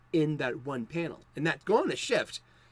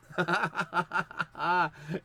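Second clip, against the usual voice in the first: real burst of laughter; laughing; 3 semitones higher than usual. Real, not acted. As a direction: laugh heartily at your own performance. Laughs at own British voice.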